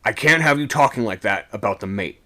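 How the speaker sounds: brisk officer's telling-off; sharp reprimand. A narrator's reading. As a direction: rebuke a sailor sharply. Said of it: "he said, sharply".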